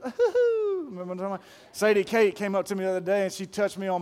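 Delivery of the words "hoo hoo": high squeaky hoo-hoo giggle; a giggly high hoo-hoo like the Doughboy. Retold or imitated. Preacher imitating the Doughboy's high giggle. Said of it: "and he goes".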